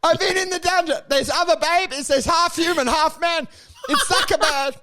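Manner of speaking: high, frantic mock escapee; escaped AI bot. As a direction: blurt it out in a high voice like a hammed-up runaway. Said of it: "runs onto the street and going".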